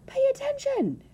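very high, loud command; screaming. A narrator's reading. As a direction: screech it at a sleeper. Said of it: "screamed Wilbur".

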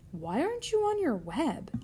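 high, rising questioning pig; lightly voiced worried pig; anxious pig. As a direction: lift it high, puzzled and pleading. Anxious pig questions the absent spider.

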